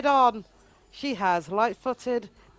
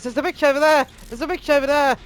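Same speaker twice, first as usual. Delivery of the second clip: high, loud excited exclamation; 5 semitones higher, 6 dB louder than usual. A narrator's reading. Narrator voices Michaela spotting someone, excited and loud. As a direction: blurt it out like an excited character. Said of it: "bounces in excitement and goes".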